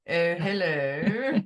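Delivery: slow laughing phone hello; laughing telephone hello. Pretend. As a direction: giggle through a posh phone greeting. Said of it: "this telephone voice".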